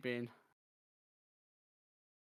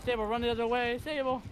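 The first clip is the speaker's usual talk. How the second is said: louder, higher urgent yell; shouting; 8 semitones higher, 5 dB louder than usual. Real, not acted. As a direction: shout the warning harder. Warning teammate to flee.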